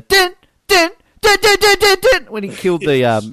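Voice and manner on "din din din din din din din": high, loud staccato "din" hits; imitated dramatic music stabs. Podcast host mimicking the film's dramatic music, high staccato stabs. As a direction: punch out suspense-score stings by voice. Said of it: "dramatic music as much as the".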